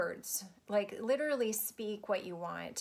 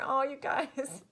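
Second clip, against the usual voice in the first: ordinary talk, nothing marked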